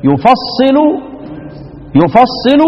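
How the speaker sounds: يفصل said "yufassilu"; يفصل as "yu-fas-si-lu"; doubled sad. Shown as a demonstration. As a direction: double the s in يفصل, u first, then a and i. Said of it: "واللي هي التشديد كمان".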